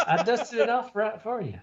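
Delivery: high-pitched, sugary gift-giving voice; silly high voice. Pretend. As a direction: chirp it out sweet and eager. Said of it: "Why are we talking like that?".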